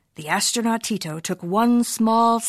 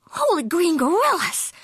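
gulping; 9 semitones higher than usual. Acted. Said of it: "gulped Skipito".

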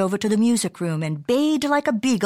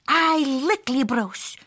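higher, slow, lightly voiced pup declaration; declaring; 5 semitones higher, slower than usual. A narrator's reading. Pup hero in the library, declaring slowly. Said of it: "declared El Skipito Frisquito".

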